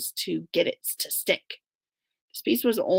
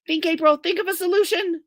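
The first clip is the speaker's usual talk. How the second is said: self-urging; 8 semitones higher, 4 dB louder than usual. A narrator's reading. Narrator urging herself.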